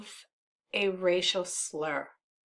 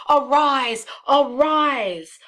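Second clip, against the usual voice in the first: rousing call; 6 semitones higher, 8 dB louder, slower than usual. Acted. Iago calling Brabantio to rise.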